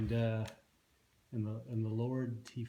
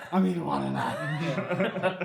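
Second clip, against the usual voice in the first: dragon voice; 4 semitones higher, 6 dB louder, much slower than usual. Retold or imitated. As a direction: drawl it out like a dragon, laughing. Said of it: "You're like".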